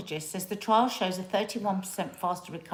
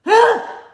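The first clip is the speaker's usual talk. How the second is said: sudden loud high gasp; fake gasp; 15 dB louder than usual. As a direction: gasp to startle someone. Jump-scare prank.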